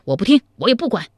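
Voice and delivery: fast, high, loud cut-in; hasty interruption. A narrator's reading. Zhao Kuangyin hurriedly interrupts Zhao Pu, agitated and fast. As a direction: rush to stop him talking. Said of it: "忙将赵普的话打断".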